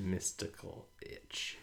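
breathy whisper of the phrase. Real, not acted. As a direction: whisper it, savoring.

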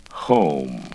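ordinary delivery

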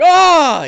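loud, high-pitched cry; big delighted "Ah!"; overjoyed outcry. Acted. Big Al's outcry on coming out.

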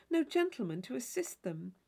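plain reading voice

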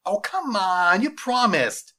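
high, loud, drawn-out protest; pleading boy. Acted. Boy begging his teacher to keep a promise, loudly pleading. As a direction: whine it like a kid owed a promise.

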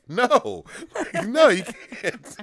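high, loud, slow laughing refusal; mock French guard. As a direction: refuse with smug delight. Guard refusing in retold scene.

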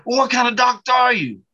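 high, loud mock demand; indignant challenge. Church lady questions his doctor title, indignant.

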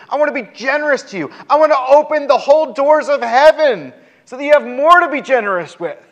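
high, urgent preacher voicing God; impassioned invitation. God inviting the people into friendship, impassioned and high. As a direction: plead with rising fervor, pitched well up.

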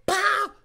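loud, high "pow"; punchy mock impact noise; imitated punch sound. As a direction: shout it as a comic punch. Acting out a punch.

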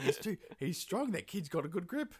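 high-pitched, baffled bear making excuses; comic beaten bear, voice climbing; flustered bear. Acted. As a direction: protest in a high, bewildered voice. Retelling a beaten bear's excuses.

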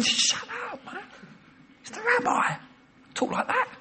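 high-pitched mock scolding hush; hushing crowd. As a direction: shush him like an irritated bystander.

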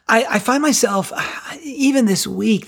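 usual speaking voice

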